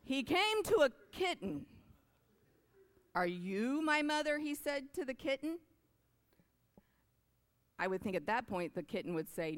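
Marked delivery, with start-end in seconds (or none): 0.0-5.6 storybook reading. A narrator's reading. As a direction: read it like a bedtime picture book. Reads picture book aloud.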